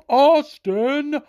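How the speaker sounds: slow, stretched-out high announcer call, each name drawn long; ring announcer imitation. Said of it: "Buffer did not even make it sound that great".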